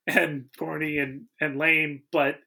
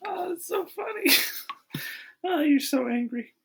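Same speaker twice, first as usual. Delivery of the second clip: high, breathy giggling words; amused at the angry friend; laughing; 13 semitones higher than usual. Real, not acted. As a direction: talk through the laughter.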